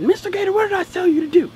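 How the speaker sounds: high, loud mock reprimand; scolding teacher. Teacher catches pupil up from his seat, mock scolding. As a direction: imitate a teacher's sharp reminder.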